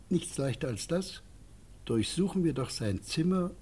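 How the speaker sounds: ordinary talk, nothing marked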